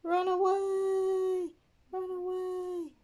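high, slow mock panic, run away; high playful cry of pretend panic; mock panicked cry. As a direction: ham up fleeing in fright. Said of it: "I'm gonna run away from this one".

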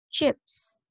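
Chips with a drawn-out hissed PS ending; Chips, lingering on the final S; stressed final sound. Shown as a demonstration. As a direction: model Chips, making the last consonants clearly heard. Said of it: "final sound, chú ý nè".

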